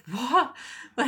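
high, loud disbelieving "what?"; mock shock. Audience reacting to the line, loud mock shock. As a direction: blurt it out as a stunned onlooker.

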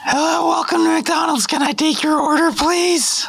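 put-on high, polite teen cashier; chirpy drive-thru kid behind the counter; fast food cashier voice. McDonald's cashier roleplay.

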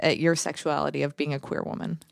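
normal talking voice

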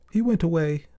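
put-on high child's voice, giving in; tired girl. A narrator's reading. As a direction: admit it to your mother in a small high voice. Tired girl tells mother.

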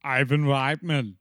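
put-on Austrian action-star accent; Schwarzenegger. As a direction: do the name in a mock Austrian accent. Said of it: "the way Schwarzenegger does it".

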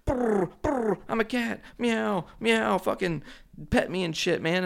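high, trilling talking cat; cat's voice. Acted. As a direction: squeal it as a needy, mocking cat impression. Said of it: "and then she's like".

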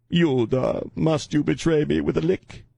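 high, theatrical biblical reproach; mock betrayed lament. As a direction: play the betrayed master, hammed-up. Mock betrayal speech to the cat.

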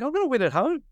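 high, giddy certainty, voice lifted way up; hyped-up young surfer sure he'll win at home; excited. Retold or imitated. Young surfer sure of winning at home, excited. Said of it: "And I was like".

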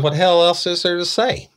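high, loud incredulous outburst; exasperated outburst. Retold or imitated. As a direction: fire it back at her in disbelief. Detective exasperated at suspect.

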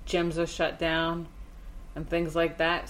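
ordinary delivery

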